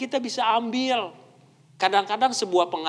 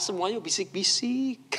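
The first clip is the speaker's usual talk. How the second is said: hushed, softer demonstration of lovers' talk; soft whispering; 7 dB quieter than usual. Shown as a demonstration. Couple still dating speak close, soft whispering. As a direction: drop to a soft murmur. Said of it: "Halus ngomongnya".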